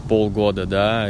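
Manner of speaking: ordinary delivery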